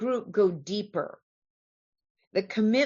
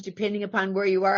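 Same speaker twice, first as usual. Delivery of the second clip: normal talking voice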